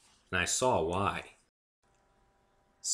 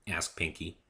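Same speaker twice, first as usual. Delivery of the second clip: ordinary delivery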